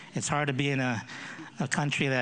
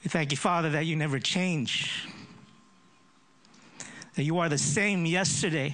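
earnest prayer voice, a little raised. Acted. Pastor leading the congregation in prayer, earnest. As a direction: pray aloud before the church.

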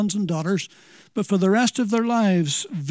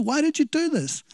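mild scolding; 7 semitones higher than usual. Retold or imitated. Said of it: "if I could paraphrase".

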